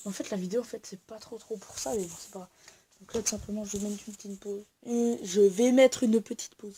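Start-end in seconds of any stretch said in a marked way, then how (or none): 4.8-6.7 louder, emphatic restatement; loud, emphatic repeat. Real, not acted. Vlogger repeats the pause announcement to viewers, loud and emphatic.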